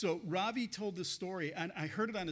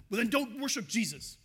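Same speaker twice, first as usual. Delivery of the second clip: imitating a furious man, loud and higher; retold outburst, raised voice; mad retort; 6 semitones higher, 10 dB louder than usual. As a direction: throw the angry comeback at him, loudly. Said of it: "he was just so mad".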